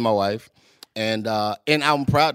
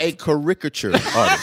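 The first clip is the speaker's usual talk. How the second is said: "caricature" with the beat on RIC; misplaced stress. Real, not acted. Guest repeating it for baffled friends, misplaced stress. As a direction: stress the second syllable of "caricature". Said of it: "I put the emphasis on the wrong syllable".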